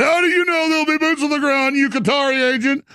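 high, strained mock outrage; indignant accuser. People accusing a war hawk of treachery, mock indignation.

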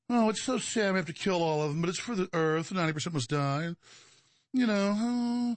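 high, put-on sorrowful villain voice; mock lament. Mimics plotters mock-lamenting mass killing.

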